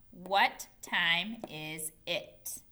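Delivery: slow, evenly separated words. Shown as a demonstration. Teacher reads the written question to pupils, word by word. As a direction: give each word its own beat, slowly.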